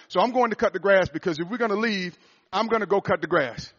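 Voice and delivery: loud, high, driving sermon delivery; preaching at full force. Acted. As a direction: build it up like a revival preacher. Preaching climax, voice rising.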